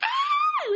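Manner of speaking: mock movie scream, very high; overdramatic scream. Imitating a horror trailer's overdramatic scream. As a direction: shriek like a cheesy horror trailer. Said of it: "over dramatic, screams".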